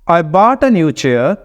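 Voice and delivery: slow reading with BOUGHT drawn out and "I" unstressed; stress on content words. Shown as a demonstration. Teacher repeats the example for learners, stressing BOUGHT and NEW CHAIR. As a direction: punch "bought" and "new chair", glide over "a". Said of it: "bought को stress कर रहा हूँ".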